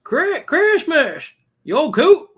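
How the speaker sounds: put-on slow, loud, high old man yell; old man shouting. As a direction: bellow it to a deaf old man. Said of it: "I couldn't hear what you're saying".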